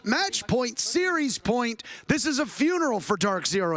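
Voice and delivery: rising hype call, voice climbing high; excited. Real, not acted. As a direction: build the call to match point. Caster hype on match point.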